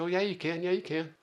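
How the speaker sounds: high-pitched, quick pep talk; cheering on. Retold or imitated. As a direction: imitate crewmates urging a friend on. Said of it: "gave me a little cheering session".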